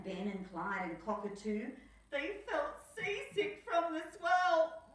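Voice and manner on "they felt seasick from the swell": dramatic read. A narrator's reading. Dramatic seasick line.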